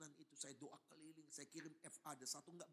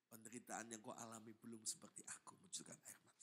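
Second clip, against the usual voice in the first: low, hushed answer from above; voice of God; 5 semitones lower, 6 dB quieter than usual. Acted. God answers the suffering pastor, low hushed voice. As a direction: sink into a soft near-whisper. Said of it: "saya dengar suara".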